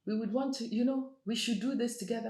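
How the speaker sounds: high, sugary mock agreement; high fake-sweet yes-yes; fake agreeable. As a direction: play along sweetly while hiding annoyance. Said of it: "I'm like".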